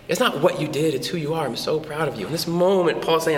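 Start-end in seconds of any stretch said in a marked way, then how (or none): 0.1-2.3 softer, hushed sincere praise; tender pride. Retold or imitated. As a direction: say it gently, heart to heart. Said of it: "I was like".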